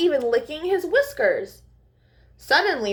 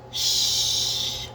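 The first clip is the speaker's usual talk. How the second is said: sharp whispered shush; breathy whispered hush. Acted. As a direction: hush them like a cross bird. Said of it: "he grumbled".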